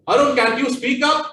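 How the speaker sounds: loud, high-pitched quoted request. Retold or imitated. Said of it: "This is the volume that they, they spoke to me".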